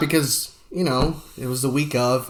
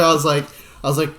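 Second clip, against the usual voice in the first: normal talking voice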